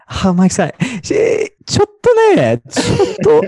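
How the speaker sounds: very high, hesitant Japanese listener; Japanese students. Retold or imitated. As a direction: imitate a squeaky, hedging student. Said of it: "And they're like".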